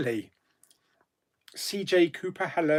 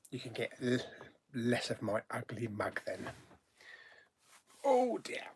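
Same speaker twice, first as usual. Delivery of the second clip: ordinary talk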